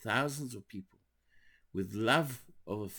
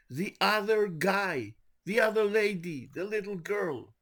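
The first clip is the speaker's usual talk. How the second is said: higher, louder fervent sermon delivery; preaching at full force; 10 semitones higher, 5 dB louder than usual. Acted. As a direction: preach it out to the congregation, slow and insistent. Preaching the Rebbe's teaching.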